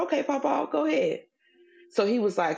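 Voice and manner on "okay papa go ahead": high, playful go-ahead; teasing dare. Retold or imitated. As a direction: dare him to try, amused and high. Teasing husband to try calling.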